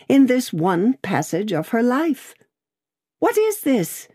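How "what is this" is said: high-pitched alarmed question; breathless, confused cry. A narrator's reading. Lorry to sudden night visitors, breathless confused cry. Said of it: "breathless and confused".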